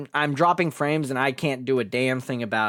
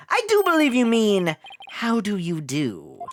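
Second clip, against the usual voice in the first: haughty prosecutor; 7 semitones higher than usual. Acted. Haughty prosecutor correcting Phoenix.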